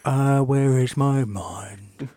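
slow eerie whisper. Pretend. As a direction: breathe it out slowly. Whispered song line.

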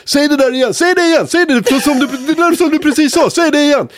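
high, laughing demand; egging him on to repeat the joke; delighted officer begging for the line again, high; eager cop. Retold or imitated.

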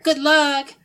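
high, loud send-off; calling out. Acted. Sushi Kid wishing departing adventurers luck, cheerful shout. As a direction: call it out cheerily after them.

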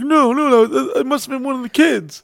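high panicked squeaky denial; panicked husband. Retold or imitated. Husband caught, denying in a high panic. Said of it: "He's like".

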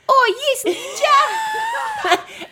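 put-on South African accent, loud and laughing. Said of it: "my South African accent".

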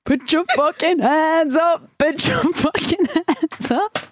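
high, slow, stretched hype yell; DJ hype shout. Pretend. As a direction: hype up the crowd like a DJ. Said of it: "you have to shout".

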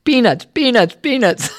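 high, sing-song hawker's call; stadium vendor hawking. Shown as a demonstration. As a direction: call it out like a ballpark peanut seller. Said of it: "He had me practice".

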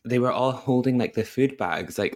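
ordinary talk

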